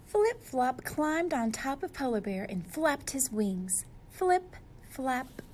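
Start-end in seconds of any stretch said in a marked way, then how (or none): none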